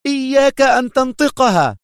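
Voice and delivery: enraged warning shout; furious shout. A narrator's reading. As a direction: cut him off in rage. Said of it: "قاطعته ثائرة".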